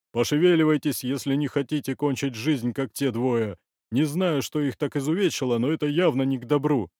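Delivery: loud, lightly voiced old chief; powerful old man. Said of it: "мощный старческий бас".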